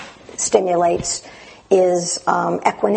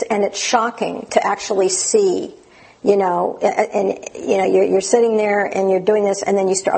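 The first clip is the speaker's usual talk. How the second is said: usual speaking voice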